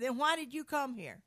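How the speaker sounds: acted-out indignant question; retold challenge.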